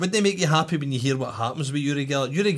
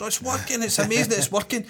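high-pitched imitation of excitement; mock amazed caller; 8 semitones higher than usual. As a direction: gush like an astonished phone-in caller. Acting out amazed phone-in callers.

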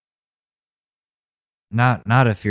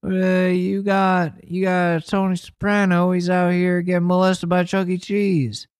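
mock politician apology; 7 semitones higher than usual. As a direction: play a politician saying sorry. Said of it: "An apology to the Italian Americans".

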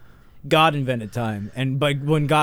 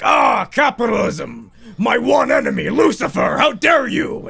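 high, loud, slow mock outrage; God shouts at Lucifer in hammed-up fury; outraged God; 9 semitones higher, 5 dB louder than usual. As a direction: shout it like a wounded deity. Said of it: "And he's like".

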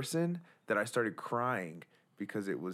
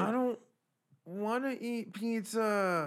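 put-on high, slow whining child; whiny kid voice; 11 semitones higher, slower than usual. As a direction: whine it like a bratty kid. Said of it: "like, Kendall whines".

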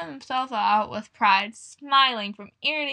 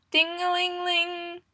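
bell imitation; 8 semitones higher, slower than usual. Bell sound in story.

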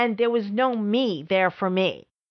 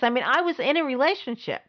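ordinary talk, nothing marked

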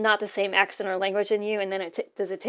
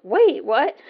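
higher, louder surprised reaction; surprised double take; 5 semitones higher, 8 dB louder than usual. Retold or imitated. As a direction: blurt it out in startled disbelief. Acting out own sudden realization.

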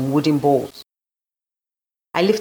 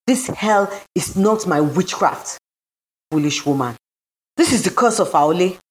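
spat back; 4 semitones higher than usual. A narrator's reading. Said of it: "she spat back".

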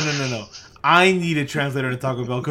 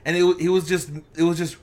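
usual speaking voice

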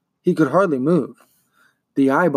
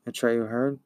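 plain reading voice

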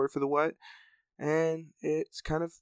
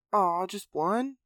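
slow, deflated and high; disheartened; 6 semitones higher, 4 dB louder, slower than usual. Acted. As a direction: let the hope drain out. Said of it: "asked with a disheartened frown".

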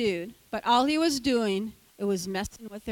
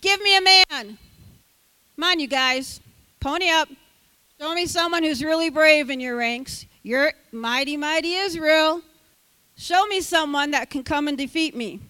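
higher, louder jeering challenge; shouting taunt; 4 semitones higher, 6 dB louder than usual. Acted. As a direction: bellow the dare at the ranks. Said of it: "the Philistine said".

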